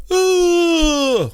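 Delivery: put-on high wailing toddler grunt; toddler cry. Toddler flinging the box, high crying grunt. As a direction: cry out like a toddler tossing food.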